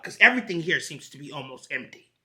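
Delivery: ordinary delivery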